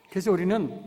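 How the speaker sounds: normal talking voice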